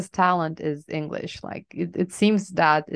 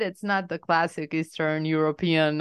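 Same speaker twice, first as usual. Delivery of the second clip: usual speaking voice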